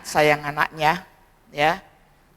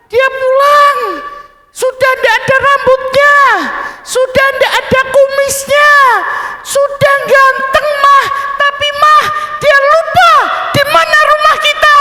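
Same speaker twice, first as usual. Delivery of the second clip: loud, much higher crying shout; crying child; 8 dB louder than usual. Acted. Sobbing boy telling mama papa forgot their house, loud high wail. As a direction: howl it through tears, outraged. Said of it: "anak itu sambil menangis".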